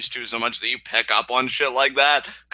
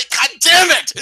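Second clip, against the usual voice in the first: explodes high and loud in joy; overwhelmed fan cry; delighted outburst; 13 semitones higher, 7 dB louder than usual. Real, not acted. Host bursting out over his favourite wrestler. Gushing over Kairi Sane.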